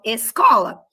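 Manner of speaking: "escola" with a crisp s, es-KO-la; sibilant S. Shown as a demonstration. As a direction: say "escola" with plain s before the k. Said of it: "eu falo escola".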